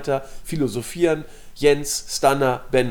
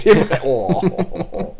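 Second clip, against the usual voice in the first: cracking up mid-word; laughing; 4 semitones higher, much slower than usual. Real, not acted. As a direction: lose it to laughter. Breaks into laughter.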